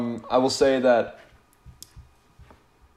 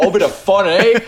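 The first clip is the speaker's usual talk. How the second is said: higher, louder cheeky rogue impression; imitating TV character; 5 semitones higher, 4 dB louder than usual. Mimicking a character barging into a sad moment, cheeky and raised. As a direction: playfully break the mood with a mock catchphrase. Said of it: "And then he just walks in".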